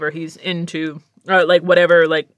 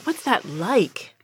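slow, softer sigh of a question; slow wistful aside; 5 dB quieter, much slower than usual. Retold or imitated. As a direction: drag it out, quietly envious. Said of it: "I'm like".

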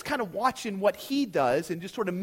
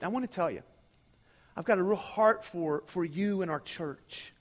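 earnest sermon appeal. Real, not acted. Pastoral appeal to church.

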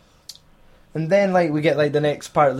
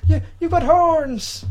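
much higher, slow, hammed-up startled cry; mock shock; 13 semitones higher, slower than usual. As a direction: act out a man seeing horns, mock horrified. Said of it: "he's like".

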